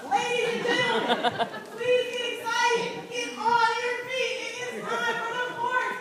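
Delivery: high, slow, stretched-out ringmaster call; hyped event announcer. Real, not acted. Host opening a poetry slam to the audience, hyped announcer. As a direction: draw out every phrase, building to a huge shout.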